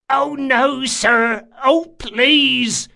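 high, pleading, slow panicked whine; terrified begging. A narrator's reading. Bandit begging Locke not to drop him, terrified plea. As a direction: beg for your life.

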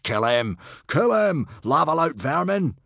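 high, loud battle cries, building; mob crying out. A narrator's reading. Hooligans advance with swords, crying out. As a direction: yell for blood. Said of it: "while crying out".

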